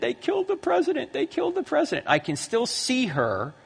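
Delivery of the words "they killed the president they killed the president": mildly distraught, high-pitched voice; high retold wail, not full sobbing; imitated weeping mother. Mother telling her toddler about the president, mock-tearful high voice. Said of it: "weeping uncontrollably".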